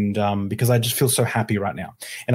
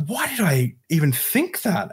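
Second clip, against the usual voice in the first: baffled realisation; 6 semitones higher, slower than usual. Retold or imitated. Retold realisation, questioning own thought.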